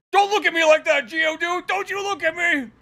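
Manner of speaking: high, hammed-up defensive yelling; defensive outburst. Acting Bruno lashing out at Geodude.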